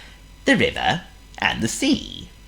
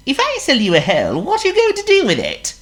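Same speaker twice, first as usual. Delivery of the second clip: very high squeaky worm; 6 dB louder, faster than usual. Acted.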